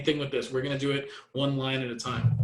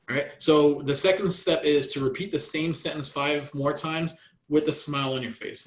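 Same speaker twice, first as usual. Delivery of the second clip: normal talking voice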